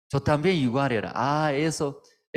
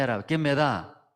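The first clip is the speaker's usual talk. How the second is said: ordinary talk, nothing marked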